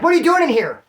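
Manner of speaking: high, loud "What are you doing in here?"; startled shout. Retold or imitated. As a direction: catch an intruder, shocked. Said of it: "somebody's like".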